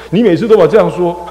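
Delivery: high, loud mock reproach; scolding mother. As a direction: imitate a mother fed up with excuses.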